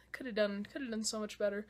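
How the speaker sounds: soft, sighing letdown; disappointed murmur. Real, not acted. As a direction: sigh it out softly. Disappointed sigh.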